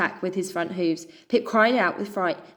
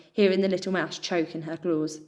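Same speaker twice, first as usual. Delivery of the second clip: plain reading voice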